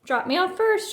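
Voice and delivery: put-on high, loud kid shout; a cub shouting on the way to the car. A narrator's reading. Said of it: "shouted Brother".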